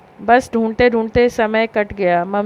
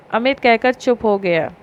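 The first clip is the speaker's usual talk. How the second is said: ordinary delivery, nothing marked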